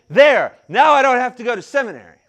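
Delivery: loud, victorious declaration; half-mocking triumphant outburst; triumphant shout. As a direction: proclaim it like a won battle. Triumphant retold quote.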